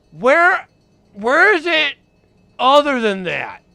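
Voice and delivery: high, loud demanding; mock outraged question. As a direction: shout the question in mock alarm. Guest asks loudly in skit.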